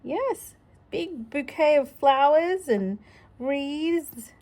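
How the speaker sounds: ordinary delivery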